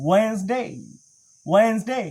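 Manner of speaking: "ওয়েডনেসডে" as WENZ-day, silent first D; British pronunciation. Shown as a demonstration. Teacher models British weekdays for learners, "ওয়েডনেসডে" as WENZ-day. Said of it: "British standard pronunciation অনুযায়ী শিখব".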